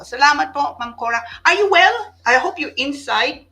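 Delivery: loud, high, bright check-in; cheery greeting. Real, not acted. Host greeting a viewer loudly and high. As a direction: call out happily to a friend.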